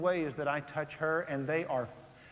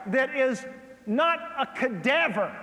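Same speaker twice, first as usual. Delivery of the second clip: high, loud and slow punchline; slow, loud punchline delivered from the pulpit; emphatic preaching; 7 semitones higher, 6 dB louder, slower than usual. Real, not acted. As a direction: hammer home that a wife is not a cadaver. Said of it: "preaching at full force".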